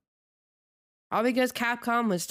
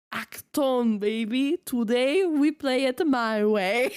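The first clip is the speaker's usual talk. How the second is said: slowed, hammed-up American-accented catchphrase; exaggerated American accent; 3 semitones higher, slower than usual. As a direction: lay the American accent on thick, laughing. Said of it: "American accent intensifies".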